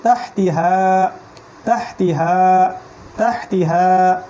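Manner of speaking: slow তাহতিহা with the final "haa" held long; drawn-out ending. Shown as a demonstration. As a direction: stretch the last vowel of তাহতিহা. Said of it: "এইখানে আবার টান দিতে হবে".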